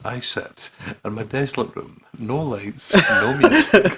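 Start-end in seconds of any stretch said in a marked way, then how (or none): 2.9-4.0 slow, high, loud laughing. Real, not acted. Reading the desolate room line, cracking up. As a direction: break into laughter mid-line.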